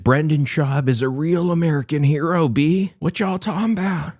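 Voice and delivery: over-the-top fake admiration, rising high; sarcastic praise. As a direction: cheer him on with heavy sarcasm.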